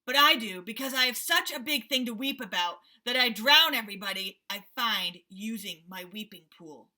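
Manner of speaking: loud angry rhinoceros; angry threat. Acted. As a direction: threaten to drown him. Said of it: "said the angry voice".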